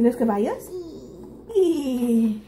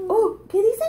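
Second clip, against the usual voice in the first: put-on reading to a toddler; 11 semitones higher, 4 dB louder than usual. Parent quizzing toddler.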